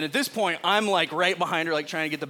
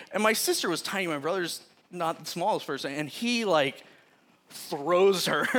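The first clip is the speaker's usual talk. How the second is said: ordinary talk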